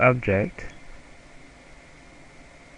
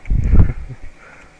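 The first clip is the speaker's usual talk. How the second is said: loud laugh; 3 semitones higher, 12 dB louder than usual. Real, not acted. Laughs at own stumble.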